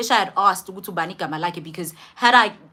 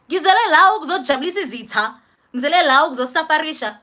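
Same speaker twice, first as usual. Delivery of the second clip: higher, louder, fast desperate exclaim; exasperated outburst; 8 semitones higher, 7 dB louder, faster than usual. Retold or imitated. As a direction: blurt it out in despair. Retold despair, exclaiming.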